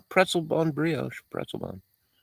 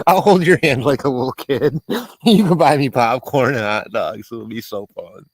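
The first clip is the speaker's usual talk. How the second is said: put-on playful little kid; 4 dB louder than usual. Teasing Dave, playing a child.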